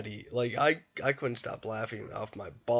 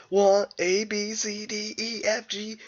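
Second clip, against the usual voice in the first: put-on higher, singsong kid reciting the alphabet; childish recital; 7 semitones higher than usual. Man acting like a child for his therapist, higher singsong recital. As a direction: play a bratty child rattling off letters. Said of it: "acts like a straight-up child with his therapist".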